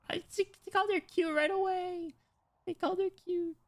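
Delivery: extremely high tiny squeal; real delighted fangirl squeak; gushing squeal. Real, not acted. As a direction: squeal giddily at an adorable detail. Breaks off reading to gush.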